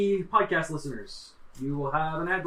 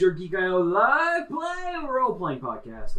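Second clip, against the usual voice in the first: radio producer voice; 4 semitones higher, 3 dB louder than usual. Acted. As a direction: put on an over-the-top radio producer voice. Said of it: "weird radio producer voice".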